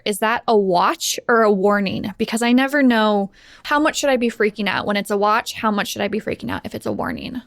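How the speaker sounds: ordinary talk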